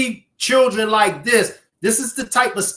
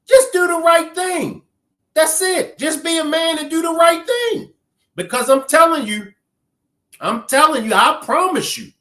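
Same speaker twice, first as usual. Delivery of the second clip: high, insistent appeal; urgent pleading; 8 semitones higher than usual. Real, not acted. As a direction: implore him with a raised pitch. Pleading with the man.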